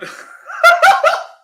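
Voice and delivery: high, loud burst of laughter; sudden real laughing fit. Real, not acted. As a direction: crack up helplessly mid-story. Breaks up telling the story.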